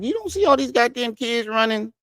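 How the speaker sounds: high, incredulous push-back; exasperated retort. Retold or imitated. Answering the commenter in disbelief, exasperated. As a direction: toss it back like it's obvious. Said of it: "I was like".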